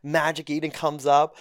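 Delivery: ordinary talk, nothing marked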